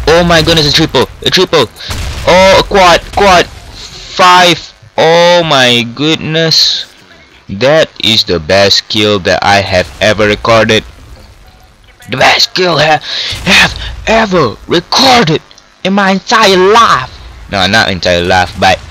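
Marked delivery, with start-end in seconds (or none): 0.1-6.9 high, mounting excited exclamations; amazed excitement. Real, not acted. Gamer racking up a multi-kill, amazed excitement. 12.1-17.1 high, emphatic triumphant proclamation; thrilled boasting. Real, not acted. Player celebrating the best kill, thrilled boasting.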